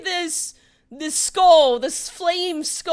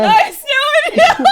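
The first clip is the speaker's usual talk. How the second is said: laughing shout; 13 semitones higher, 12 dB louder than usual. Real, not acted. DM echoes player line louder.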